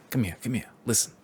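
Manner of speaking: confiding New York Italian paisan giving directions; New York Italian stranger sharing a secret route; New York Italian accent. Retold or imitated. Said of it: "he's like".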